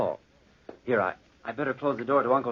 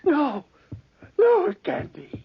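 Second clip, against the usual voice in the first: much higher, slow, horrified; panicked denial; 4 dB louder, slower than usual. Acted. Guilty Charles to himself, horrified and high-pitched. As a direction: recoil in dread from the sound.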